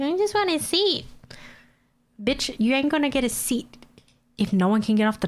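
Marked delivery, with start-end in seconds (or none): 0.0-1.1 lightly voiced high whiny woman; mock her, pitched up and petulant. Mocking the rude tram woman to viewers, pitched up and whiny.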